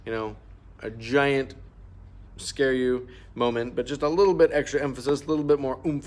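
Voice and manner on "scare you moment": ordinary talk, nothing marked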